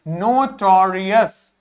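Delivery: notorious drawn out slowly, each syllable clear; slow model pronunciation. Shown as a demonstration. Teacher models नोटोरियस slowly for students. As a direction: say नोटोरियस slowly for the class to repeat. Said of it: "तो क्या पढ़ेंगे".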